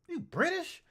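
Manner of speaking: high, loud incredulous question; shocked disbelief. Retold or imitated. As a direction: blurt it out in stunned disbelief. Retold shock at DNA results.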